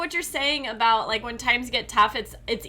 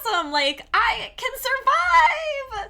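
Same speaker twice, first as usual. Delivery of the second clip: excited exclamation; 8 semitones higher, 4 dB louder than usual. Retold or imitated. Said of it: "So I was like".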